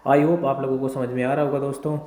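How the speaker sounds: ordinary talk, nothing marked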